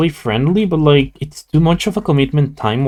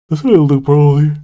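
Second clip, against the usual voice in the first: yawning through the word; 4 dB louder than usual. Real, not acted. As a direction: speak mid-yawn, tired. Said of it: "sorry for yawning so much".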